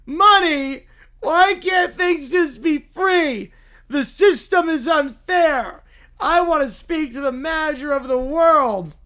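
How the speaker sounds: loud, high, drawn-out wail; whiny Little Bear. Acted. Little bear learning they are unemployed, loud whiny tantrum. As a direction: throw a slow, bawling tantrum at full volume.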